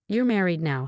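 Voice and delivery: ordinary delivery, nothing marked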